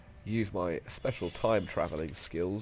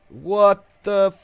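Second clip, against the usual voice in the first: loud, high, shocked outburst; 13 semitones higher, 12 dB louder, much slower than usual. Real, not acted. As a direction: cry out in disbelief. Shocked by a game event.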